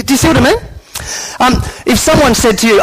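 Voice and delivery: ordinary delivery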